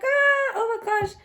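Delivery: very high, loud gasp of delight; imitated thrilled shriek. Daughter imitating mom hearing pregnancy news, thrilled. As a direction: squeal it like an overjoyed mother.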